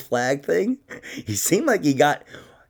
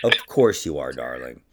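dry retort; 12 semitones lower than usual. Retold or imitated. Dry repeat of his retort.

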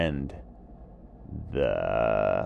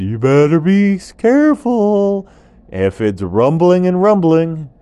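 loud, high urgent vulture warning; a worried bird cautioning its friend; 5 semitones higher, 8 dB louder than usual. Acted. Vulture warns iguana carefully.

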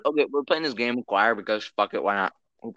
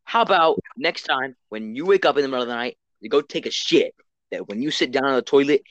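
ordinary talk

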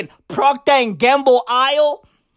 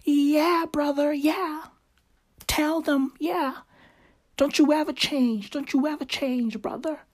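soft, higher whispered cheering from the background; hushed sister egging on; 3 semitones higher, 8 dB quieter than usual. Retold or imitated. Dictator's sister eggs on brother from background: soft hushed cheer. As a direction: murmur adoring support behind him. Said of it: "his fucking sister is in the background like".

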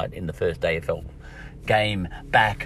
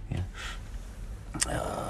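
normal talking voice

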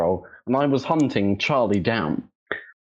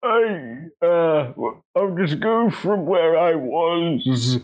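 put-on old-timey old man voice; 5 semitones higher than usual. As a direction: tell it like a rambling old-timer. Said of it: "like, an old-timey old man voice".

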